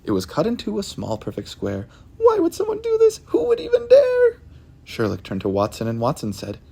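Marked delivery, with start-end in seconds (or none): 2.2-4.4 very high, loud indignant cat voice; outraged old cat wailing; upset Missus Hudson. Acted. Missus Hudson finds her blanket cut, shrill very high outrage.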